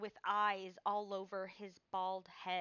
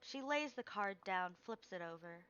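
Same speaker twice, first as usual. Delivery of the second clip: ordinary talk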